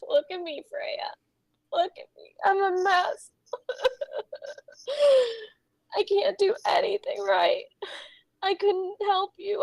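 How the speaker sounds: self-pitying tearful breakdown; crying, rushed in bursts, voice giving out; sobbing and muttering. Acted. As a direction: ramble through sobs, trailing into whispers. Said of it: "Just sobbing now".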